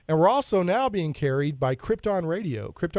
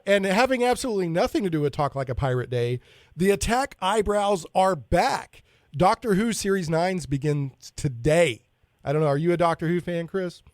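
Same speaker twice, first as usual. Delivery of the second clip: ordinary talk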